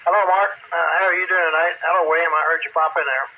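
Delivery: normal talking voice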